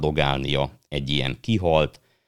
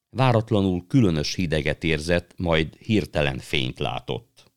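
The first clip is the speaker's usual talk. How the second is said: ordinary delivery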